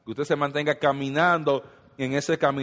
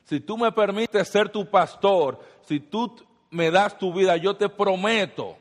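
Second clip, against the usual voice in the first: promesa de Dios; 7 semitones higher, 3 dB louder than usual. Acted. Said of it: "Él dice".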